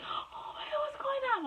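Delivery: thin, very high panicked squeal, acted out; hysterical. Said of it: "I'm all hysterical and everything".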